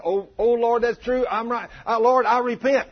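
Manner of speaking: high, pleading confession; mock urgent repentance. Driver repents to the Lord before a wreck, mock urgent pleading. Acts hurried repentance to God.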